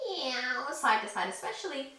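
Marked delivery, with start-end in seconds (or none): 0.1-0.7 light meow; a little kitty meow; cat meow. Shown as a demonstration. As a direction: toss off a quick playful meow. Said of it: "really swoosh your tail".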